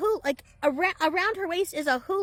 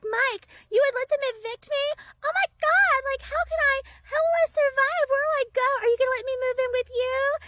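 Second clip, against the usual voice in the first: put-on much higher, louder panicky little-girl voice; hysterical childlike wail; 13 semitones higher, 5 dB louder than usual. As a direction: melt down like a frantic child. Tenant loses it again, panicking.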